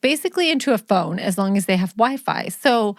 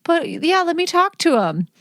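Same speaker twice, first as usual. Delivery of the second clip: much higher, sugary polite voice; sweet phone voice; 8 semitones higher, slower than usual. Retold or imitated. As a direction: sound nice for the school nurse. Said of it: "and I'm like".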